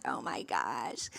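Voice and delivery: very low, slow, hushed mutter; overwhelmed dismay. Retold or imitated. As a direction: let it sink out, drained and drawn-out. Acting out overwhelmed reaction afterwards.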